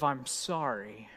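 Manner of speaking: low, slow, hushed sorry; soft sincere sorry. Shown as a demonstration. Pastor voicing the heart of the prayer, soft and sincere. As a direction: breathe the words out gently. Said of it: "It's really a prayer of".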